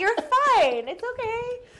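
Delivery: high, laughing reassurance; high reassuring. Retold or imitated. Reassuring the embarrassed stranger, high and laughing. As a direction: squeak it out kindly while laughing.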